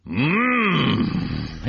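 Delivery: imitating a child's happy mm; kids' yummy hum. Kids copying their sub's yummy noise, playful hum. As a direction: echo the kids' cookie hum. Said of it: "the kids sitting next to me were like".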